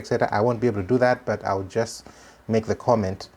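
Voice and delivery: normal talking voice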